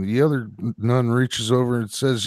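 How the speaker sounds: ordinary talk, nothing marked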